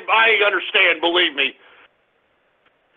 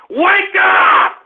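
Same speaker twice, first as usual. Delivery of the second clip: high-pitched yell to wake them; irritated shout; 7 semitones higher than usual. Real, not acted. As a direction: holler at a dozing audience. Host berates his silent callers.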